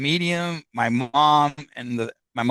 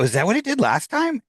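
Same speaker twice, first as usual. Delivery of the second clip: retold startled question, pitched high; astonished question; 9 semitones higher than usual. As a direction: voice jumps up in disbelief. Startled question as book moves.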